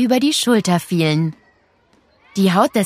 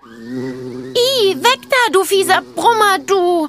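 put-on disgusted girl shouting; 10 semitones higher, slower than usual. A narrator's reading. Girl flees a wasp.

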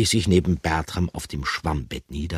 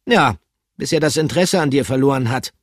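fast, brisk reply; quick blunt retort; 9 semitones higher, 8 dB louder, faster than usual. Acted. Pikandu quickly contradicts Bertram. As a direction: snap back quickly.

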